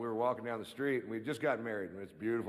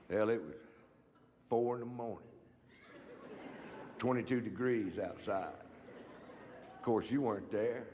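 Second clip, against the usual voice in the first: cousin Ray impression. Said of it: "my impression of my cousin Ray after the big kill".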